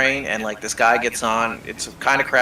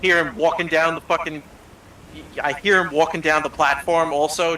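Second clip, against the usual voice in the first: ordinary talk, nothing marked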